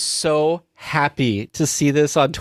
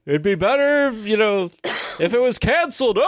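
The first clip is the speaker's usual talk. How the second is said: high-pitched heckler impression; imitated balcony heckler with a big laugh; Waldorf; 7 semitones higher than usual. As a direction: crack the insult, then laugh at it. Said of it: "Waldorf and what's his name?".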